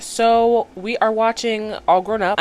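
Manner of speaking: slow, drawn-out intro line; slow announcement. Real, not acted. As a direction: spell out the title slowly. Announces the episode, slowly.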